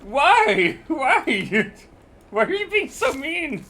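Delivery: high loud whiny protest; mock outrage. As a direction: wail it in mock hurt.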